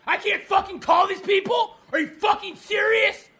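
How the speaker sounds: high, loud disbelieving yell; outraged shouting. Acted. Caller explodes at worker in disbelief.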